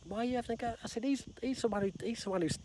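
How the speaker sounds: put-on high-pitched indignant child's complaint; protesting schoolkid. Pointing him out to the guide, protesting schoolkid. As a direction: tell on someone like a kid in class. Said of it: "like I was at school".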